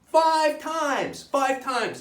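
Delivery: loud, high cry of outrage; exasperated emphasis. Retold or imitated. Comic telling audience of biopsy ordeal, exasperated shout. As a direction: shout the count in comic horror. Outraged recount of being shot.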